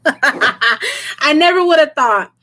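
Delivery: slow, laughing through emotion; emotional laugh. Real, not acted. Touched by nearing ten thousand subscribers, laughing emotionally. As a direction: laugh it out while choked up. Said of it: "why am I getting emotional?".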